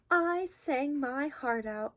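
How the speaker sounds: ordinary delivery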